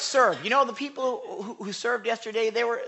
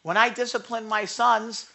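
ordinary talk

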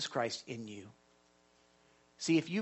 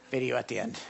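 laughing aside; 7 semitones lower, 7 dB quieter, faster than usual. Real, not acted. Pastor laughs while dropping the video.

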